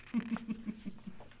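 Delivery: short chuckling giggle; amused laugh. Real, not acted. Reader amused by the disobedient pet, short giggle.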